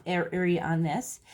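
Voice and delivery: normal talking voice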